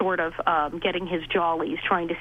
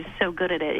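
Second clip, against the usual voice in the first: ordinary talk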